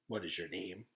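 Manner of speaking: low, soft, flat question; cold questioner. A narrator's reading. The stranger questioning Jenny, low and soft. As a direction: interrogate calmly.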